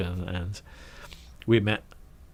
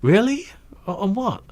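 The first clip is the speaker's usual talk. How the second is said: much higher and louder, delighted; excited Guy; 12 semitones higher, 8 dB louder than usual. Retold or imitated. Guy hearing he starts work Monday, thrilled. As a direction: gush with surprise.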